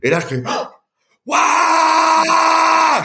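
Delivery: gasp, then a long, very high cry; big cry. Retold or imitated. Swimmer crying out as he lands on the rock, very high and drawn out. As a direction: let out a huge, slow scream. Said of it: "Je pousse un grand cri".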